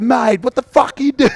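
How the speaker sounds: very high, loud mock shout; mock outraged shout. Coach yelling at a beaten defender, high mock shout. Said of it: "he'd be like".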